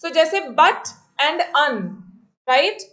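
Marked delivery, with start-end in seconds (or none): 0.6-1.8 "but" and "un" said as two separate syllables; split into syllables. Shown as a demonstration. Teacher showing students two syllables, "but" and "un" said apart. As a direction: break the word into "but", then "un". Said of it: "दो syllables हो गए यहाँ पे".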